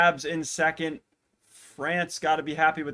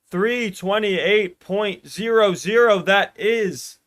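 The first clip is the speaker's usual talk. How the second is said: excited time call; 6 semitones higher, 9 dB louder, slower than usual. Real, not acted. Announcing winning time.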